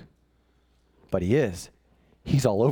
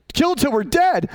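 mock dramatic; 10 semitones higher, 4 dB louder than usual. Comic exaggeration of Esther's words.

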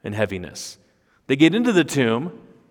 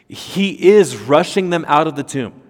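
ordinary delivery

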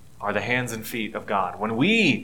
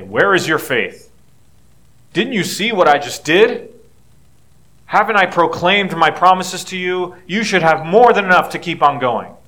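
loud, raised-pitch mock reproach; imagined divine rebuke; 6 semitones higher, 7 dB louder than usual. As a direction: scold them as an exasperated God might. Voices God's imagined rebuke.

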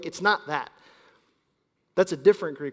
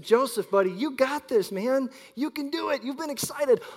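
put-on higher, upbeat coaxing of a little boy; encouraging; 7 semitones higher than usual. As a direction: cheer him on gently. Parent encouraging son.

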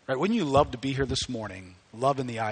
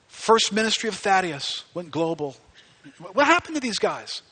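loud, high, rapid questions; excited preaching; 8 semitones higher, 5 dB louder than usual. Real, not acted. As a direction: fire off questions with urgency.

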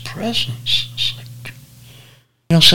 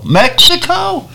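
Mexico? jumps much higher in disbelief; shocked disbelief. Retold or imitated. Realizing the van is heading into Mexico, stunned. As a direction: squeak out Mexico? as a stunned question.

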